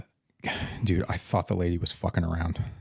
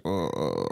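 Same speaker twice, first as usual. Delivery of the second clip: low, softer mock groan; imitated moan; 7 semitones lower, 5 dB quieter than usual. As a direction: groan like a sick patient dodging a question. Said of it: "he just starts moaning".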